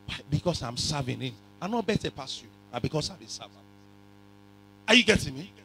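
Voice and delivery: normal talking voice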